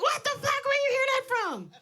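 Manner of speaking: high-pitched, outraged mother imitation; mom yelling. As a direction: imitate a mom shrieking in disbelief. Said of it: "she's stomping and yelling so much".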